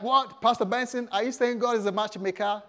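mock astonished question; incredulous congregant. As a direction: act out a taken-aback listener questioning the preacher. Voices a surprised congregant questioning him.

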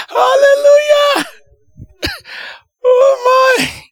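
high, loud, slow crying-out of praise; ecstatic praise. Real, not acted. Preacher to the congregation, high ecstatic hallelujah. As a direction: shout hallelujah overwhelmed with joy. Praise after healing story.

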